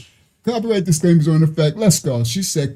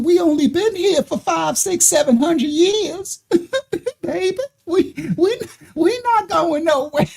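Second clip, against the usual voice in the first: mock Southern auntie; 10 semitones higher than usual. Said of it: "she got that old soothing Southern talk".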